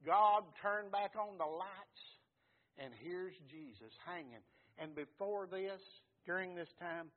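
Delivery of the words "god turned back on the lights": slow, loud and raised; preaching shout. Acted. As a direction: declare it like a triumph. Preacher climaxing.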